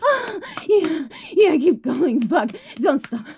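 performed moaning with gasps and cries; breathy moaning. Acted. Streamer performing for her chat, moaning throughout. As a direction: moan through every line, pleasure building.

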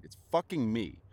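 ordinary talk, nothing marked

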